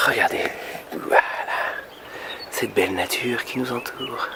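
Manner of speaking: ordinary delivery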